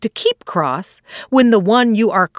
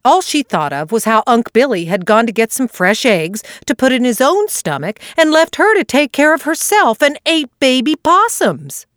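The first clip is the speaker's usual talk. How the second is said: plain reading voice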